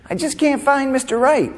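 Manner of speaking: high-pitched mock complaint; single women's lament. Comedian mimics single women who can't find love, high mock lament.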